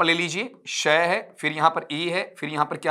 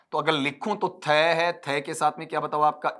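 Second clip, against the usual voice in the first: normal talking voice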